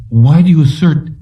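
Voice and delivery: high-pitched, pressing question; insistent inquiry. A narrator's reading. Whitwer challenges Anderton's refusal to surrender, insistent inquiry. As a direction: press for an answer, pitched well up. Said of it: "Whitwer inquired".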